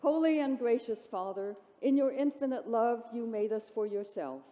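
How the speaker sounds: ordinary delivery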